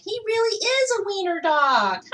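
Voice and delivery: high, loud mocking; high loud jeer; jeering dogs. A narrator's reading. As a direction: laugh at him out loud. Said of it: "they cried".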